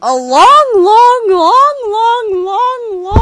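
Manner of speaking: each long drawn out, rising high; milk the long for comic effect; stretched-out long. Acted. Two narrators opening a parody, stretching long.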